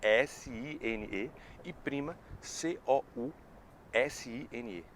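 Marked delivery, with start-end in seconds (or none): 0.0-1.3 slow spelled letters; spelling out. Shown as a demonstration. Teacher spells the word slowly for students. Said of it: "cozinha, cuisine".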